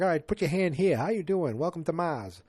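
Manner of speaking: bright, high mock-cheery check-in voice; perky receptionist greeting. Natasha Lyonne checking people in on Mars, perky high greeting. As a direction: play a chirpy front desk greeter.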